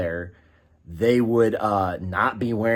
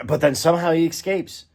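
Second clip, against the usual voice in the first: ordinary talk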